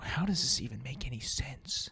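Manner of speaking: hushed, wondering whisper. Real, not acted. Comedian marveling at babies, softly whispered. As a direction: breathe it out in awe.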